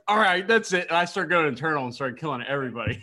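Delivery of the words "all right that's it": high, loud mock fury; mock fed up. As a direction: pretend you've snapped. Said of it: "I was like".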